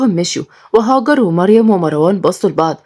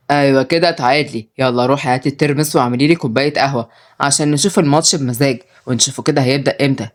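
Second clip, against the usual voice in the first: lower-pitched domineering man; bossy Osama; 5 semitones lower than usual. Acted. Osama sends Sumaya for coffee, bossy and low. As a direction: order her about in a low voice.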